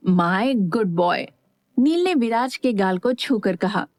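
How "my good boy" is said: fond praise. A narrator's reading. Uncle praising nephew fondly.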